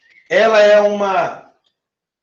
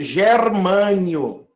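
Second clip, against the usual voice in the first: drawn out syllable by syllable; Germânio spelled out slowly, each syllable held; slow demo; much slower than usual. Shown as a demonstration. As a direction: stretch it for the class. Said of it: "procuro o nome do elemento".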